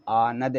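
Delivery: आनद drawn out as aa-nad; आनद with a bare final d; without nasal. Shown as a demonstration. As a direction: say आनद slowly, no n before d. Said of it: "और बिंदी लगा दें".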